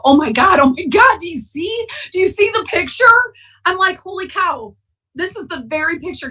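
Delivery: high, breathless "oh my God"; retold amazement at the TV; excited disbelief. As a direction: grab your husband's arm in shock. Seeing Danny's picture on TV.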